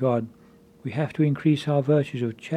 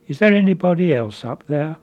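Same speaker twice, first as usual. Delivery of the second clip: loud call to the clifftop, pitched up; shouted call upward; 3 semitones higher, 5 dB louder than usual. Retold or imitated. Man on the tree shouts up, wanting another rescuer. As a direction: yell up hoping for someone else. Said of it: "and he shouted out".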